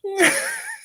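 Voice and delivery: high rising laugh; real helpless giggle. Real, not acted. Laughing at the story.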